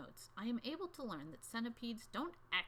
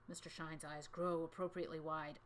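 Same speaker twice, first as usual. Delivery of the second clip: usual speaking voice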